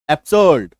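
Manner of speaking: एब्सर्ड low, as "ab-suhd"; silent R. Shown as a demonstration. Teacher introducing the word, एब्सर्ड with silent R. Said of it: "आर के बाद D है".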